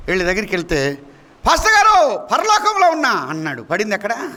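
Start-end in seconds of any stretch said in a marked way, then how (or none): 1.5-3.1 high-pitched mock holler; retold cry, pitched well up; calling out. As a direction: imitate a drunk man calling to his pastor. Drunk man calls from the drain.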